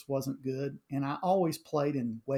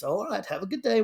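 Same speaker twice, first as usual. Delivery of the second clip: breezy goodbye; 6 semitones higher, 4 dB louder, faster than usual. Retold or imitated. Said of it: "And he just".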